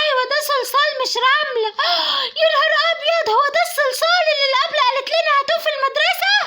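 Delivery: put-on high child's voice with a gasp and a laughing cry; a little girl panicking over forgotten homework; alarmed little girl. Little girl remembering the teacher asked for clay, high and alarmed.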